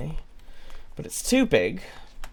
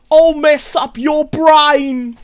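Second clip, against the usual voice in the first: Bloodborne enemy yell; 13 semitones higher, 11 dB louder, much slower than usual. Retold or imitated. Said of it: "They'd say it like that".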